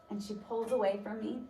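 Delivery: usual speaking voice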